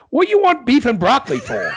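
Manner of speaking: high, loud outraged wife's yell; indignant wife's shout. Retold or imitated. Old wife to husband in bed, loud high shout. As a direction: bark it back at him, scandalized. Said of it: "She goes".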